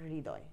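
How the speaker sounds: হৃদয় softly, breath first, then ridoy; hri-doy for হৃদয়; h before ri. Shown as a demonstration. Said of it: "আগে হটা উচ্চারণ হবে".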